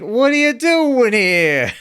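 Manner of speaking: high, slow, loud squeaky voice; high squeaky Squiggy voice. Retold or imitated. Quoting Squiggy line.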